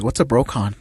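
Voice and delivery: plain reading voice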